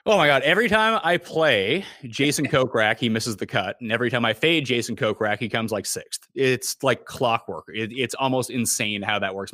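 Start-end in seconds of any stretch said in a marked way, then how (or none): none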